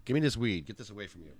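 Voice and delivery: normal talking voice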